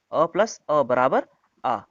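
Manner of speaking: अ plus अ बराबर आ said slowly, word by word; slow explanation. Shown as a demonstration. Teacher explains अ plus अ to the class, slowly. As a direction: spell it out slowly for students. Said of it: "क्या होता है?".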